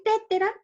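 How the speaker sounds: "tétera" stressed on the first syllable; high first te in "tétera"; misplaced stress. Shown as a demonstration. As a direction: say "tétera" as TE-te-ra, questioningly. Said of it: "Es correcto decir".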